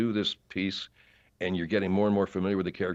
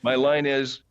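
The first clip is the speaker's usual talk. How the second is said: usual speaking voice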